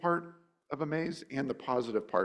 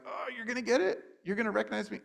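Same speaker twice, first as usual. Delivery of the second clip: much higher, playful teasing voice; teasing Jesus; 10 semitones higher, faster than usual. Retold or imitated. Jesus teasing his disciples, higher and playful. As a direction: tease them like a knowing friend. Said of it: "I kind of picture Jesus kind of going like".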